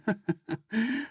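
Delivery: light drowsy giggle; a faint, dreamy little laugh; soft weak laugh. A narrator's reading. Drugged girl laughs weakly.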